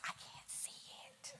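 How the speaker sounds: soft voiceless whisper. Retold or imitated. Repeating the audience's complaint, whispered. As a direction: hush it right down to a whisper. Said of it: "Oh, I say".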